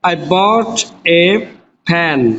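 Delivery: each word drawn out slowly for the class; slow dictation. Shown as a demonstration. Teacher dictating slowly to students. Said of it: "বাই অর্থ কিনা আর বট অর্থ কিনলাম".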